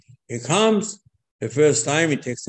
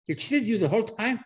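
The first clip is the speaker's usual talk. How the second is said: ordinary talk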